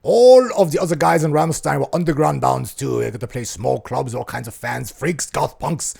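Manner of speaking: put-on German-accented rock singer. Podcaster telling of the East German punk scene, German accent. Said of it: "this sounds like [name]".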